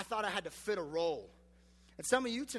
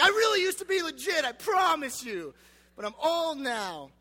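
desperate plea; 8 semitones higher, 8 dB louder than usual. Retold or imitated. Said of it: "I'm like".

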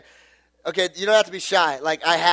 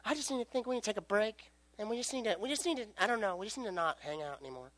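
soft breakup; 2 semitones higher, 16 dB quieter, faster than usual. Retold or imitated. Girlfriend breaking up quietly.

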